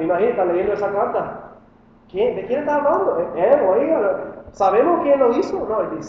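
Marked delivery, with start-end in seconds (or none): 2.1-5.6 curious wondering. Pretend. Imagined congregation wondering who.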